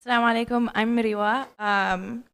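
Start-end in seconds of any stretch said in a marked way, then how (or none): none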